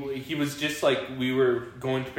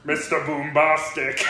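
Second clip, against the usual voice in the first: comic reggae singer impression; 3 semitones higher, 3 dB louder than usual. Husband jokes about who was missing from the song, singer impression. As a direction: put on a dancehall star's catchphrase voice. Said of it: "a little bit of Shaggy".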